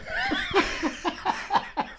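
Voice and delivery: breathless high laugh; wheezing laugh. Real, not acted. Laughs at own punchline.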